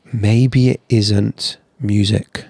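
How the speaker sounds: very slow, dragged-out admission; reluctant admission. Retold or imitated. Letting go of his music dream, a slow reluctant admission. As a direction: pull each word out reluctantly. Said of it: "hard to pull away and say".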